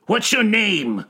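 high, forceful demand. A narrator's reading. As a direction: demand an answer on the spot. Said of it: "Cinderbox demanded".

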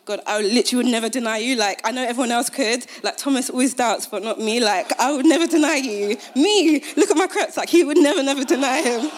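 loud, high, fast boastful protest; Peter boasting. Retold or imitated. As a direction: overconfident Peter, protesting too much. Peter boasting he'd never deny.

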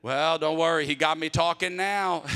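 godfather's joke. Retold or imitated. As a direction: toss off the punchline loudly. Godfather's punchline.

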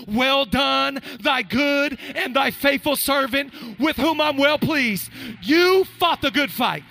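loud, high, driving sermon cadence; full-force preaching. Acted. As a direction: preach it to the back row.

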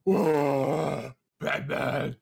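put-on superhero voice, drawn out; Batman voice. Joking about the suit constricting his vocal cords, put-on Batman voice. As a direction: make a mock dramatic superhero entrance. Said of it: "I just like doing Batman's voice".

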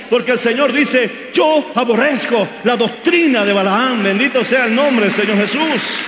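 más alto y fuerte, predicando con fervor; proclámalo como en el púlpito; predicación a toda voz. Acted. (predicación con fuerza, más alta y más fuerte)

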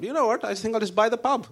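a hint of his dad deciding on a whim; mildly mock dad voice; dad's voice. Dad's thought voiced in story.

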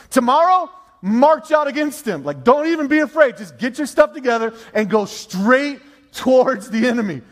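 high, forceful marching orders, easing at the end; rally the people like a preacher; rousing command from the pulpit; preaching. Acted.

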